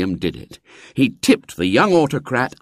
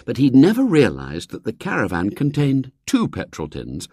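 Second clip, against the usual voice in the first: plain reading voice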